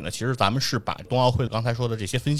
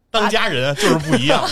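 teasing; 11 semitones higher, 8 dB louder than usual. Pretend. Teasing the host about bills.

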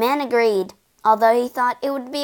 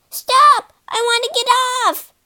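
high, urgent plea to get off; frightened ghost's high-pitched yelp; panicked cry; 13 semitones higher, 5 dB louder than usual. A narrator's reading. Terrified ghost on roller coaster.

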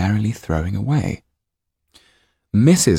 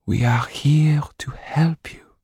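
slow higher whisper; softly; 10 semitones higher, slower than usual. A narrator's reading. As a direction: reassure him in a hush. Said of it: "said his wife softly".

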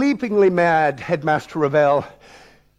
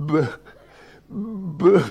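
halting attempts at a first sound; stammered false starts, long pauses; stuck stammer; 3 semitones higher than usual. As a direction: try for the word and stall on the b. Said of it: "I paused before the deadly word. It wouldn't seem to come".

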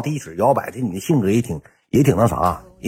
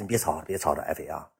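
压低声音劝; 5 semitones lower, 12 dB quieter than usual. Retold or imitated. 劝媳妇别吵.

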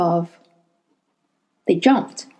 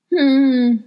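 put-on old man grunt; 5 semitones higher, 4 dB louder than usual. A narrator's reading. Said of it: "the old man grunted".

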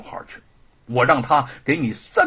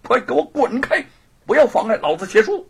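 haughty, angry denunciation; scornful tirade rising to a dismissal; indignant; 4 semitones higher than usual. Acted. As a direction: berate him with growing indignation. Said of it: "怒视着狱卒".

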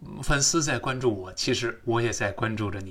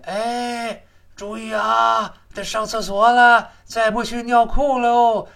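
high, loud, slow nagging inner voice; inner body voice; 10 semitones higher, 8 dB louder, slower than usual. Pretend. The body's voice calling out to its owner, urgent and high. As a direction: shout the warning like your own bladder. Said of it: "身体里面有个声音在叫你的".

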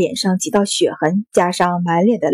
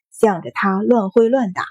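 ordinary delivery

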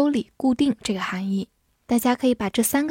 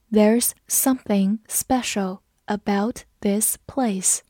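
slow, careful read of the sentence; slower than usual. Shown as a demonstration. Teacher reading slowly for students to follow. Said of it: "我们慢读一遍".

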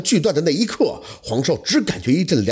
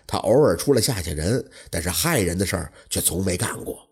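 lower, softer innkeeper with a long sigh; old innkeeper telling; 7 semitones lower, 4 dB quieter than usual. Acted. Innkeeper telling Huang Shou the ghost's story, low and soft. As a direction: innkeeper recounts the haunted house history.

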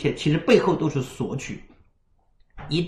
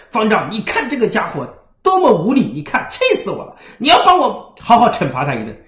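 raised, louder aggrieved monk; indignant complaint; 6 semitones higher, 4 dB louder than usual. Retold or imitated. Little monk protesting to the abbot, indignant and loud. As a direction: demand punishment in a huff.

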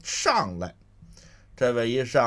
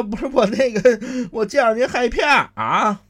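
high, loud, cowering plea; frightened pleading; 11 semitones higher, 6 dB louder than usual. Acted. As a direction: cringe and whimper the excuse. Thug admits fear.

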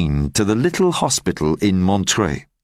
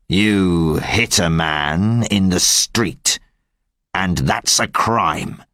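a slightly lower, slow and stern police inspector; lightly voiced stern inspector; 4 semitones lower than usual. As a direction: speak as a hard-line inspector laying down the law. Javert arrests Fantine.

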